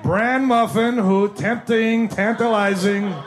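high, drawn-out sarcastic sales pitch; mock enticement. Kid hawking a bran muffin to classmates, mock-enticing. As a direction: tease out each word like a delicacy.

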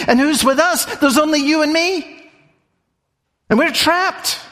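high, fast, rising with alarm; the servant protests he has seen the army; agitated disbelief. Acted. Preacher voices doubting servant.

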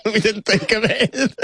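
high laugh spilling into words. Real, not acted. Admitting to the host they had no plan, laughing. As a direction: laugh through the admission.

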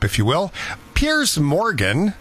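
ordinary delivery

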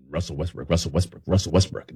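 rapid low name drill; vocal warm-up drill. Shown as a demonstration. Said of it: "especially when you're doing play-by-play".

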